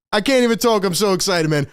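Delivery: normal talking voice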